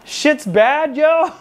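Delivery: put-on high, rising Cambodian-accented outrage, each yo jumping up; imitating Cambodian accent. Staff sergeant waking him at his bunk, Cambodian-accented angry squeal. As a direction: mimic the sergeant's Cambodian accent, pitch climbing to a yelp. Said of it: "he heated".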